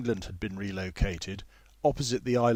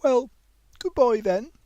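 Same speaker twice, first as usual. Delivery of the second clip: high, louder awkward farewell; awkward goodbye; 13 semitones higher, 7 dB louder than usual. A narrator's reading. Older woman awkwardly saying goodbye to the young man, high. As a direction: say goodbye a bit awkwardly, pitched up. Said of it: "awkward, girlish wave".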